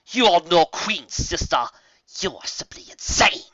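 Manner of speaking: haughty villain voice; scornful dark alicorn. A narrator's reading. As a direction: sneer at your mad sister. Dark sister defies the queen.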